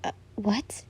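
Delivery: whispered. A narrator's reading. Couldn't hear the mumble.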